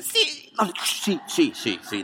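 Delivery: squeaky high rush of yeses; giddy mock excitement; eager agreement. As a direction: imitate her gleeful nodding yes. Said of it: "Me dice".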